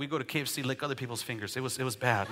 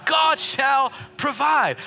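loud, higher, slow drawn-out God shall provide; preached shout; 9 semitones higher, 7 dB louder, much slower than usual. Shown as a demonstration. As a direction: belt it out to the congregation. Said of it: "someone just shout".

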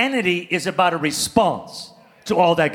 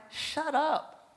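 laughing retort; 5 dB quieter than usual. Retold or imitated. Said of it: "I'm like".

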